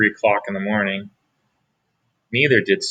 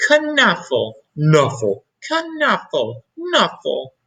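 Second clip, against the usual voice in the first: put-on child calling bunny; 6 semitones higher, slower than usual. Trixie calling her bunny's name.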